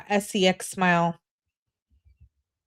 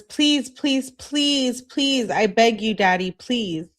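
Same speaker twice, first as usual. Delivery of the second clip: high repeated pleas, easing down at the end; put-on pleading, please after please; begging; 5 semitones higher, slower than usual. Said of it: "I say it in a begging way".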